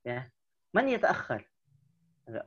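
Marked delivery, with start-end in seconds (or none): none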